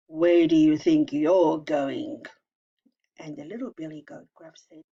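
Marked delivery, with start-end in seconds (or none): 0.1-2.2 slow, menacing troll growl, louder. Acted. Troll menacing the little goat, slow and loud. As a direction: loom over the little goat, slow and threatening.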